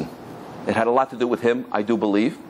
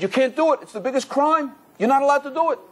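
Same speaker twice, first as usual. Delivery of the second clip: urgent; 9 semitones higher than usual. Retold or imitated. Rabbi forbids the man's plan.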